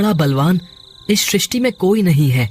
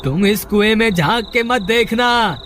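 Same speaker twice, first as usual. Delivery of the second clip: calling a warning. Acted. Trapped brother calls warning from well.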